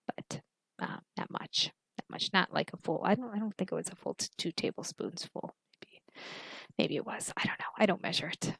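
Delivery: drowsy whisper; fading off to sleep; sleepy. Real, not acted. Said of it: "I feel like I'm falling asleep".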